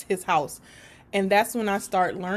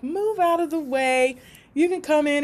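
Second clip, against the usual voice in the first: mom waving her in; 6 semitones higher than usual. Retold or imitated. Mom voiced.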